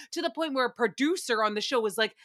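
ordinary talk, nothing marked

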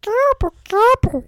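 high, loud alien gobble; Stitch voice. Pretend. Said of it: "this is my Stitch voice".

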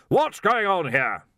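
loud, high, slow demand. A narrator's reading. Arch-Chancellor demands answers of the watchmen, commanding. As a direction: boom it out with authority. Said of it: "he demanded".

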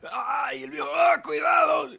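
high, loud cry of pain; pained yell. Acted. Injured old man yelps as the vet handles him, loud pained cry. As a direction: yelp as the leg is moved.

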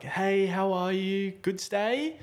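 high, slow chirpy greeting; lightly voiced bubbly host; sing-song friendly welcome; cheery receptionist.

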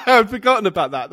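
loud laughing while speaking. Real, not acted. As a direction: laugh through the words. Laughs at remembered pact.

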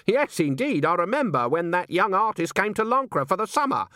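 high-voiced witch recalling pointedly; Granny. A narrator's reading. Witch studies an old picture of her friend, high and pointed. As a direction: play the imperious witch, pitched high.

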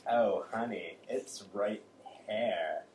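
low, soft seductive voice; low sultry voice. Acted. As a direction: purr it low and slow. Shaniqua voiced in improv.

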